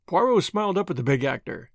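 plain reading voice

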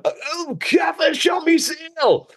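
high shrieking wake-up yell, dragged out; mock scream. Waking up at three in the morning, a hammed-up high scream. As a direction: jolt awake and scream for Catherine. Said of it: "I'll wake up screaming and I'll be like".

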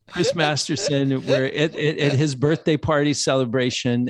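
ordinary talk

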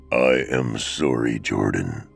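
deep echoey Death. Acted. Death answering the grieving husband, deep and echoey. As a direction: speak as Death, deep and hollow. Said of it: "Death replied in a deep, echoey voice".

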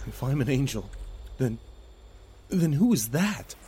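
high, hesitant, faltering voice; nervous, halting challenge; unnerved question. Acted.

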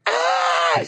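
a mock shriek, high; grandmother's startled scream, imitated. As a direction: shriek like grandma seeing the spider. Grandmother sees the spider.

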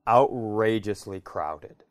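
ordinary talk

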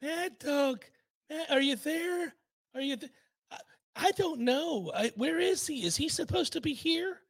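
put-on confused old coach. Coach unable to hear the radio host, soft confusion. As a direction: wonder aloud faintly, losing the thread.

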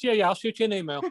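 mock casual sign-off. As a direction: toss it off fast, teasing your co-host. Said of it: "you just said to Tom McGinnis".

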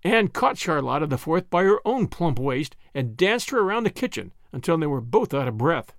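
ordinary delivery, nothing marked

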